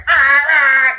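loud, high exasperated shouting; mock outraged cry; impatient yell. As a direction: bark out like someone stuck at a green light. Mimicking an impatient honking driver.

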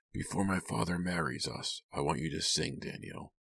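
soft, controlled voice; hushed, trailing into a whisper; hushed request. A narrator's reading. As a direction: ask quietly, sinking into a whisper. Kit's hushed menacing request to Danielle.

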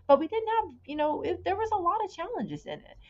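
fighting to keep talking while upset; genuinely upset, pitch straining up; real upset. Real, not acted. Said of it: "that would get really upset".

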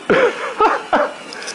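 silent breathy laugh; wheezing laugh. Real, not acted. Teller cracking up after retold yell, breathy wheeze.

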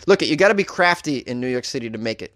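ordinary delivery

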